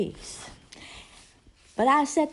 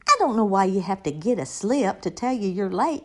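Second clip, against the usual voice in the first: normal talking voice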